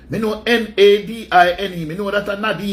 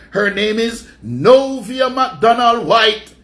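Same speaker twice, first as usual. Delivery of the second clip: announcer reveal; 2 semitones higher, 4 dB louder, much slower than usual. Acted.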